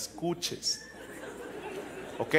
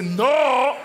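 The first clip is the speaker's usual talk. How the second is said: put-on high, loud, indignant shouting girlfriend; mock angry girlfriend; 7 semitones higher, 6 dB louder than usual. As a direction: shout it back like an offended girlfriend. Acted angry girlfriend reply.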